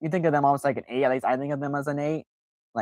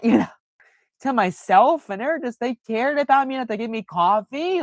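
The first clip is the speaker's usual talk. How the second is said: higher throughout, moved and rueful; mock touched self-talk; 8 semitones higher than usual. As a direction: marvel at their kindness, scolding yourself. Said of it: "and I was like".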